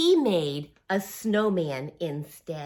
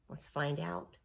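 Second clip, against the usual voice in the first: soft secret whisper; 6 semitones lower, 13 dB quieter than usual. Acted. Reading to kids, suspenseful whisper.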